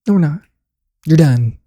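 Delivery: "No" hit high and loud, "we're not" falling. Real, not acted.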